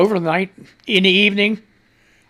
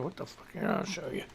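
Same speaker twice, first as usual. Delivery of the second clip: muttering; 16 dB quieter than usual. Real, not acted. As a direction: mutter it to yourself. Muttering at a shocking picture.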